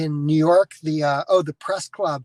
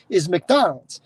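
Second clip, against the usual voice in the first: normal talking voice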